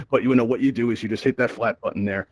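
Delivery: ordinary talk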